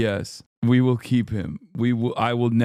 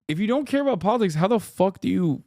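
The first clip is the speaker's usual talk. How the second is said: high, fast incredulous question; high, fast disbelief; 7 semitones higher, faster than usual. Real, not acted. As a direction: rattle it out in disbelief.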